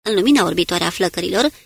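usual speaking voice

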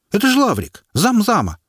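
high, loud call-out; shout from the crowd. A narrator's reading. A bold onlooker shouts out the prisoner's identity. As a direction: yell it out from the crowd. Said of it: "крикнул какой-то смельчак".

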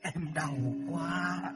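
soft, slow, pained ghostly moaning. Acted. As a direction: whimper in pain from beyond. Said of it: "tiếng của Mị rên rỉ".

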